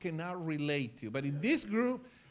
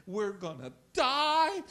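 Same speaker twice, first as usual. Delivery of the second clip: high, slow mock wail; acted doomed cry; mock panic; 6 semitones higher, 4 dB louder, slower than usual. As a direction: draw it out in imitated dread. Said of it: "massive panic".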